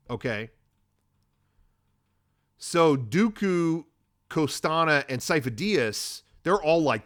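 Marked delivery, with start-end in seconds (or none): none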